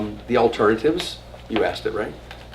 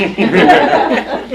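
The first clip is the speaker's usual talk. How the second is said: loud, high burst of laughter; laughing hard at own punchline; real laugh; 8 semitones higher, 12 dB louder than usual. Real, not acted. Teacher laughing at own joke with class, real laughter.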